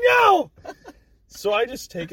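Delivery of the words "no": loud refusal. Retold or imitated. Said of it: "And I'm like".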